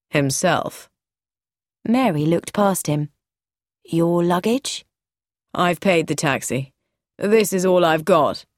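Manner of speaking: a hint of a calm, dry man. Lance's voice in the story.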